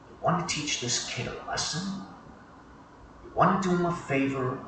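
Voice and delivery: hushed, pointed questions; whispering back. Acted. Training officer goading the rookie to hurt a kid, whispering back. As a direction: press him softly, under your breath. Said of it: "Lonnie turns to me and whispers back".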